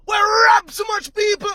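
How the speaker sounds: crying angry shout. Real, not acted. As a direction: sob it out in outrage. Crying rant about stolen money.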